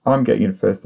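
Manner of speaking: ordinary delivery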